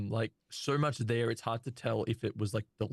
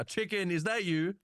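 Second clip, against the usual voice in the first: high, slow robot call; 8 semitones higher than usual. Acted. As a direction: call out slowly, higher. Thoven voiced by GM.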